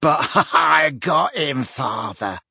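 slow gloating cackle; cackling. A narrator's reading. Morfin boasting to father, cackling. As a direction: laugh through the boast. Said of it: "cackled Morfin".

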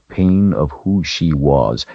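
plain reading voice

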